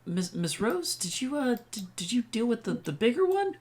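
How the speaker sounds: put-on high, hesitant, shy young voice; nervous child asking quietly; timid kid voice. Player voicing timid kid Riley.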